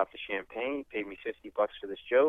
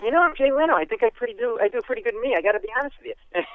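Jay Leno impression; 10 semitones higher than usual. Leno teasing a guy who doubts it's him, high Jay Leno voice. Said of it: "and Leno goes".

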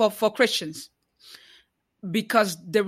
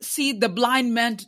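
impassioned preacher, higher and louder; preaching; 5 semitones higher, 3 dB louder than usual. Acted. Challenging Christians who do not see the oppressed, preaching. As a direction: preach it, pressing each question.

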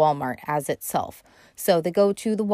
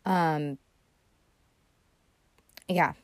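ordinary talk

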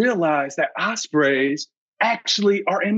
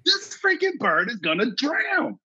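panicked; 8 semitones higher than usual. Retold or imitated. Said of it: "I'm freaking out".

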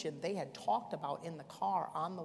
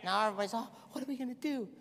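loud, high-pitched revival preaching; preaching at full force; 9 semitones higher, 12 dB louder than usual. Acted. Preacher thundering at the congregation, full force.

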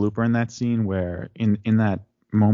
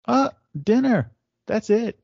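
mock accusing; 7 semitones higher, slower than usual. [name]'s accusing reenactment.

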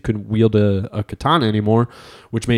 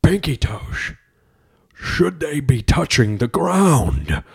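slow, higher dramatic voiceover; documentary voiceover; 4 semitones higher, slower than usual. Pretend. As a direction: ask it like a grave trailer voice. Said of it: "the voice comes over".

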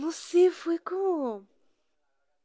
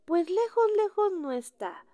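husband's breezy answer; 10 semitones higher, 9 dB louder than usual. Retold or imitated. Husband voiced in retold call.

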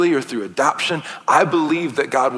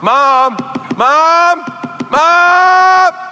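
rising, ever higher and louder calls; kids calling Mom; 6 semitones higher, 6 dB louder, much slower than usual. Retold or imitated. Kids pestering mom, building from calm call to high squeal. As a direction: start calm, climb to frantic squeals. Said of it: "asking me for something and saying".

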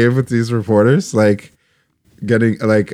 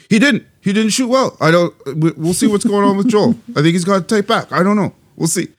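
high-pitched imitation; 10 semitones higher than usual. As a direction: coach mimicked in a much higher voice. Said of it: "Your voice is too low pitched".

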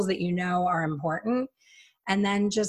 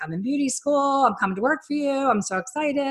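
excited gushing; 8 semitones higher, faster than usual. Retold or imitated. Young Bud gushing about beauty school.

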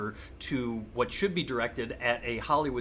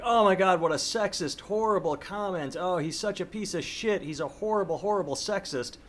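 mock outrage; 6 semitones higher than usual. Host parodying outraged critics, hammed-up mock indignation. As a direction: ham up a scandalized online mob. Mocking online outrage at Cam Newton.

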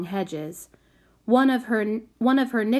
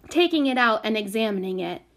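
plain reading voice

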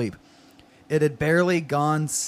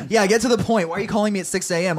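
higher, quick annoyed snark; snarky; 6 semitones higher, faster than usual. A narrator's reading. Austin to Otto calling at six A M, snarky. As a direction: snap it impatiently, pitched up and fast. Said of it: "Austin snarked".

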